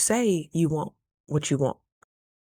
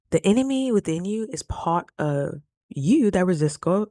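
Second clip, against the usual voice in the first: usual speaking voice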